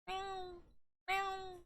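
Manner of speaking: tiny high mews, soft; kitten meows. A narrator's reading. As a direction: mew twice like a kitten. Kittens mewing in pocket.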